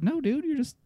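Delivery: incredulous. Retold or imitated. Retold reaction, mock reassurance.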